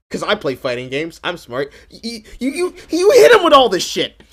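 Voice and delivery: usual speaking voice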